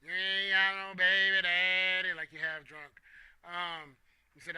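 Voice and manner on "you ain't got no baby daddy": put-on loud, drunken Southern twang on baby daddy; hammed-up Southern drawl; crazy Southern accent. Shown as a demonstration. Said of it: "say it with a crazy southern accent".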